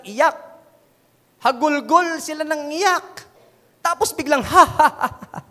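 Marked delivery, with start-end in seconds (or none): 4.5-5.4 hammed-up mocking ha ha ha; mocking laugh. Preacher imitating mourners scoffing at Jesus, mocking laugh. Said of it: "they laughed at him".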